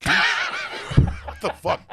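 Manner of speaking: high, slow, laughing what-the; real shock cracking into a laugh; laughing disbelief. Real, not acted. Asked if he likes quiche, laughing in disbelief.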